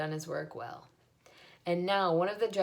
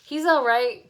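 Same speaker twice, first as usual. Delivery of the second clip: loud, higher reassurance; cried out; 6 semitones higher, 11 dB louder, slower than usual. A narrator's reading. Zuckerman cries out to the loudspeaker, loud. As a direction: call out quickly to calm the crowd. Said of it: "cried Mister Zuckerman".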